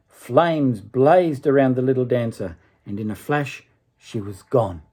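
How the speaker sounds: gentle storybook reading voice; story narration. A narrator's reading. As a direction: read it like a bedtime tale. Reads a fairy tale aloud.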